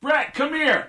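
high-pitched call to a friend. Retold or imitated. Quarterback calls his fellow player over, calling out. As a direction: summon someone over by name.

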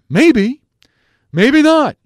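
very high, loud mock voice; Bradford impression. Host mimics Bradford's "maybe, maybe not", high and loud. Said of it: "I sound like Bradford".

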